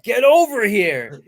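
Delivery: slow, loud, high battle cry; Scorpion shout. Retold or imitated. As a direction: bellow it like a fighter. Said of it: "You'd be like".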